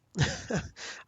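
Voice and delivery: loud breathy laugh; burst of laughter. Real, not acted. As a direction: laugh out at yourself. Streamer finds max card count.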